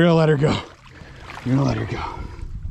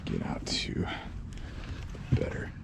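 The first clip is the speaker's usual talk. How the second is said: whispered under the breath; real whisper; 15 dB quieter than usual. Real, not acted. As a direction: hush it while watching the line. Alone, waiting quietly for a bite.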